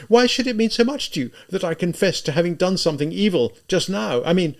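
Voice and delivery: high, loud, agitated outpouring; exasperated, anguished cry; near despair. A narrator's reading. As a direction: demand it desperately, pitched well up. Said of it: "with something almost akin to despair".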